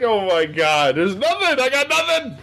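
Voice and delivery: high, strained mock pleading; hammed-up high-pitched exasperation; exasperated protest. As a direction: plead total brokeness in mock despair. Acted plea to bill collectors.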